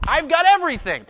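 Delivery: high, loud burst of confidence; bold confidence. Acted. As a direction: proclaim it with total assurance. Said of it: "It's confidence".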